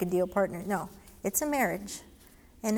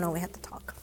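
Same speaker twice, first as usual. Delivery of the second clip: whisper; 3 semitones lower, 7 dB quieter than usual. Real, not acted. Confiding aside to listener.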